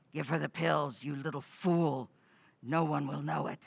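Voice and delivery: ordinary delivery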